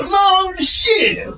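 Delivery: very high, drawn-out exclamation. Acted. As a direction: stretch it high in mock caricature. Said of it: "It's weird to go".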